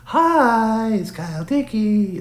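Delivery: very high, stretched-out parody greeting of a hack impressionist; Kyle Dickey impression. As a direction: push the parody further up in pitch. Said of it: "He's like".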